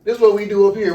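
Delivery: high, heated retold argument; indignant rant. Local youth setting police straight, retold indignant rant. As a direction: argue back with the pitch driven up.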